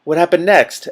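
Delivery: Two Flower on the edge of his seat, breathless; breathless eagerness. Acted. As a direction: ask it hungry for the rest of the tale. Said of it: "asked Two Flower breathlessly".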